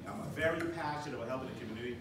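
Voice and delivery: ordinary talk, nothing marked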